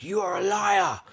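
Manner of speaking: high, drawn-out accusing cry; angry customer. Retold or imitated. Angry customer confronting the shop worker, high accusing cry. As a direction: imitate an irate customer bursting in. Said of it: "Oh, he's really angry".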